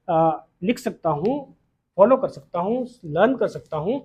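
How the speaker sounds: ordinary delivery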